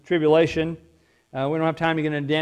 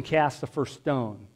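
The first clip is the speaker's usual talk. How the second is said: calm Jesus; 4 semitones lower, 6 dB quieter, slower than usual. Acted. Jesus answers the accusers.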